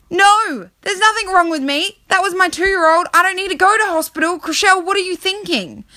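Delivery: high-pitched exasperated rant; worked-up, high comic indignation; indignant protest. Acted. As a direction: protest in mock outrage, pitch way up. Acted indignant reply to wrong alarm.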